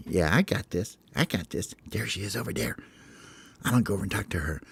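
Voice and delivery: hushed, cocky little bird; quietly sure of himself; Hope being cool. Acted. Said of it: "He's just like".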